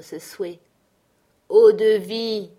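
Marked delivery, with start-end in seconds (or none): 1.5-2.5 loud, drawn-out demand for drink; exclamation. Acted. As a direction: call out each syllable slowly and loudly. Blessé qui réclame à boire. (exclamation forte et traînante)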